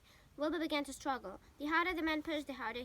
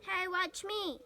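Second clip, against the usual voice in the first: put-on higher, loud boyish yell; 6 semitones higher, 5 dB louder, much slower than usual. A narrator's reading. Avery crawls into the crate, loud higher yell. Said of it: "yelled Avery".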